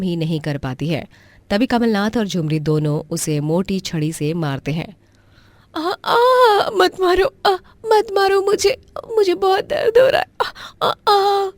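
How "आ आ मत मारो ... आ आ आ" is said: very high, louder sobbing pleas; crying in pain. Acted. Beaten niece crying and begging her aunt and uncle to stop. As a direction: beg them to stop, sobbing.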